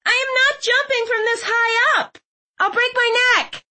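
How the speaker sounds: put-on high-pitched, indignant girl's voice; high girlish protest; alarmed girl in tower. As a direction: refuse outright in a very high voice. Plays Clove refusing to jump.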